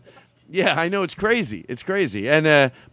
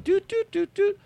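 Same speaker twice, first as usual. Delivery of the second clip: high imitated phone tones; dialing beeps. Caller dialing nine eleven, imitated dial beeps. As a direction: doot like a keypad.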